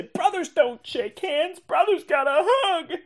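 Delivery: high, slow movie-quote voice; Tommy Boy quote. Retold or imitated. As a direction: quote it like Chris Farley. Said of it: "We would say".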